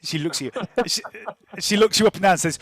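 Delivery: usual speaking voice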